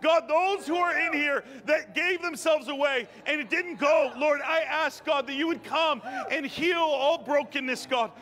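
high, impassioned exhortation; fervent preaching. Real, not acted. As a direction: preach it urgently, voicing the pleas and answers.

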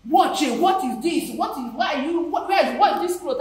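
loud retold scolding, high; mock angry boss. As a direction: bark at her like the angry director.

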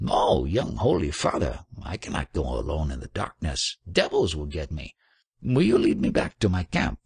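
plain reading voice

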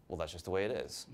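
dad voice. Retold or imitated. His dad-voice reply to girl.

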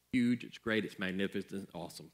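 presidential voice. Retold or imitated. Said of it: "There I go with the presidential thing again".

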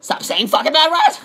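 high outburst breaking into laughter; mock scolding commenter. Imitating people who send stop-swearing comments, high mock outburst. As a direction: blurt it as a hammed-up complaint. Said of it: "people saying".

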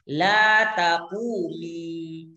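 La taqumi drawn out very slowly with a clear final i; slow model form. Shown as a demonstration. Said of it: "teacher models feminine form". (La taqumi diucapkan sangat pelan dan panjang)

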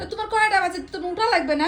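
high, insistent nagging; real scolding. Real, not acted. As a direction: push him to get up, voice pitched high. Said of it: "তুমি এত চিল্লাচ্ছ কেন". (চড়া গলায় তাগাদা)

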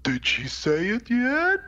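high, slow goblin squeak; comic goblin. Acted. Goblin cuts in on Sarah's wish, high drawl. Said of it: "one of the goblins going".

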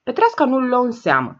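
ordinary delivery

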